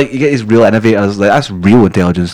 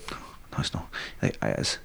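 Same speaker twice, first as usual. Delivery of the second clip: barely audible, whispered bickering; hushed mock argument; 11 semitones lower, 19 dB quieter, slower than usual. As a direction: argue it under your breath, almost voiceless. Mimed petty argument over pronunciation.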